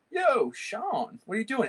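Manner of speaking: a friend barging in, greet him with delight; surprised greeting. Retold or imitated. Teacher retelling his surprise when a friend opened the studio door. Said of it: "I'm like".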